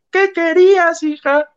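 mock the daughter from way up high; mock gringa mother. The mother scolding her crying daughter in a high mock gringa voice. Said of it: "la otra así de".

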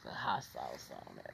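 whispered aside. Acted. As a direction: mutter it under your breath.

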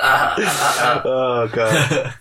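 normal talking voice

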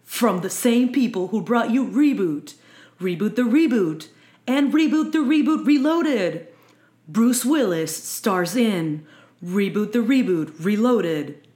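trailer announcer voice. Pretend.

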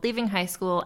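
ordinary talk, nothing marked

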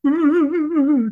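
high-pitched imitated whimper; mock whimpering. Imitating his whimpering down the rope, high mock whimper. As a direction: whimper in a hammed-up high wail. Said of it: "I love his whimpering".

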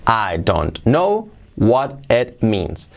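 "I, don't, know, what, it, means" spaced out; slow, unjoined words. Shown as a demonstration. Teacher before joining the words, slow and separated. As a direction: give every word its own slow beat. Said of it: "vamos a ver la unión de palabras".

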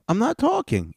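hammed-up squeaky I'm not talking; mocking the panelist. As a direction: push the repeat way up high. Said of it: "He goes".

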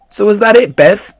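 put-on high, loud, eager kid asking; eager questioning. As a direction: press her for the answer, all worked up. Charlie eagerly questions Beth.

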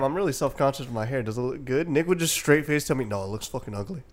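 normal talking voice

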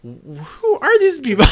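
high, loud incredulous question; baffled. Retold or imitated. Retelling meeting mob wives, shrill bafflement. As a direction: squeal it out in disbelief. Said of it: "and I was like".

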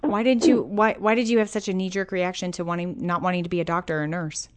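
ordinary talk, nothing marked

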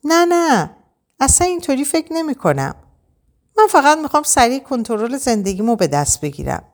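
mildly urgent interrupting protest; cutting in. A narrator's reading. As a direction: jump in insistently, pitched high. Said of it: "وسط حرفم پرید و گفت".

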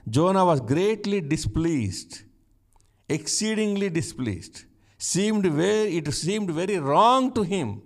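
reading scripture aloud. Shown as a demonstration. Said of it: "You look at verse one".

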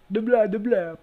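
high, loud vampire tribute; hammy vampire blah-blah; Dracula. Acted. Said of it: "It's fucking Dracula".

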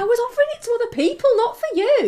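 fast, high, loud objection; playful protest. Real, not acted. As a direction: squeal a quick teasing rebuff. Playful protest at co-host's offer.

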